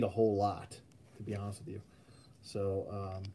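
whistling nose while talking; a nose whistle under the words. Real, not acted. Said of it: "my, my nose is whistling".

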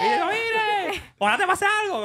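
very high, slow mocking voice of the wife; mock angry wife. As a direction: drag it out like a spiteful wife. Imitating Soli, very high. (imitación aguda y lenta de la esposa rencorosa)

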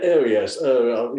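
mock posh British accent; caricatured upper-crust British chatter. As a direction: put on an upper-class English murmur. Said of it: "the upper crusting".

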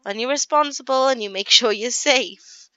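lightly voiced mum explaining gently; mum's sex talk. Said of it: "And then she's like".

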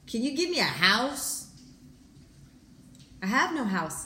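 calm storybook narration; story reading. Acted. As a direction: read it like a storybook to the congregation. Reads children's story aloud.

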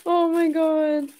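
slow, high and tearful; barely getting the words out through tears; crying. Real, not acted. Overwhelmed by the gift, slow tearful gasp. Overcome reading the gift card.

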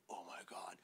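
hushed, breathless whisper of alarm; scared whisper. Retold or imitated. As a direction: whisper it like a kid who just spotted trouble. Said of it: "And he's like".

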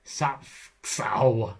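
"Sapsau" in two drawn-apart bursts, as a guessed foreign word; game stab at saying "Sapsau" in Egyptian; attempted Egyptian pronunciation. Shown as a demonstration. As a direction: have a playful go at Egyptian "Sapsau". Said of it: "not going to attempt to pronounce these".

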